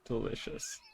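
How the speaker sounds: near-whispered, very soft reply; soft, sinister whisper; hushed relish. Acted. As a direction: murmur it under your breath. Creature answers a soup threat.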